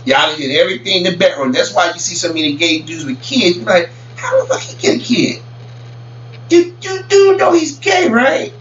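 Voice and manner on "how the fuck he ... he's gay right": incredulous. Retold or imitated. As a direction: shriek in disbelief at the obvious. Incredulous reaction quote.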